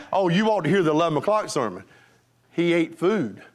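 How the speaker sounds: normal talking voice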